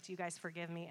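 soft, low, tentative asking; humble request. Retold or imitated. Mom asking her boys to forgive her, soft and humble. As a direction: ask quietly for forgiveness.

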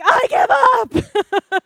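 high, drawn-out yell of defeat; exasperated shout. Retold or imitated. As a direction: throw in the towel at full volume. Acted exasperated outburst in story.

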